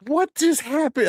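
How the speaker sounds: high, slow, stunned question; retold disbelief. Dad after son's fart prank, incredulous. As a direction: replay your baffled shock in a high voice.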